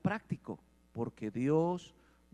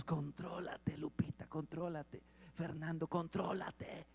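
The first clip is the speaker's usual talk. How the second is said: hushed, whispered telling-off, slow at the end; mock whisper; 3 semitones higher, 5 dB quieter, slower than usual. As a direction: hiss a warning to a friend under your breath. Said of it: "¿Cómo decimos".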